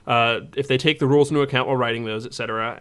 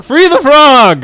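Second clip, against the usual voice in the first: much higher, much louder, slow hammed-up chant; full shout; 13 semitones higher, 16 dB louder, much slower than usual. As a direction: bellow it like a crowd at a rally. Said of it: "sent an email to Nathan and said".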